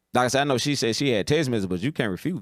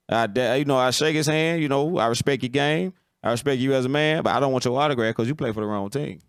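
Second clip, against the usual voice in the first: ordinary delivery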